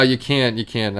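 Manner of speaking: normal talking voice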